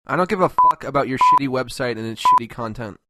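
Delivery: ordinary talk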